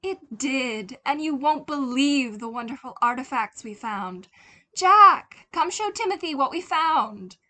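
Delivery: high, loud, bubbling with excitement; loud, eager mother calling Jack; excited mother. Acted. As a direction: call out across the house, thrilled. Excited mother calls husband.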